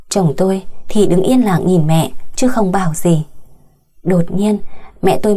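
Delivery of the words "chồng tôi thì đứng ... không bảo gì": usual speaking voice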